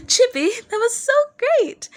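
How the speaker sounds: high, slow, delighted gush; giddy. Acted. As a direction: bubble over after the dance. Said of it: "laughed giddily".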